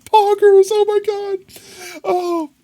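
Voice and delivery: squealing high, fading into wheezy laughter; losing it completely, tears of laughter; crying with laughter. Real, not acted. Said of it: "Oh, I'm crying".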